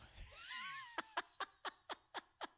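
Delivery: long, high-pitched wheezing laugh; laughing hard. Real, not acted. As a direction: crack up helplessly at your own story. Cracks up at own story.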